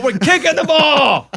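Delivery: high, loud and slow, laughing through it; laughing mock yell. Captain laughing as he yells the call to the ref. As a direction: bellow it out, cracking up. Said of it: "I said".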